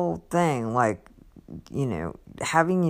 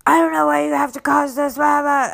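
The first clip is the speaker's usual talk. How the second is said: angry dad; 9 semitones higher than usual. Retold or imitated. Imitating the dad storming off at church, shouting angrily. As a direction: yell it as you storm off. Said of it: "he was so angry".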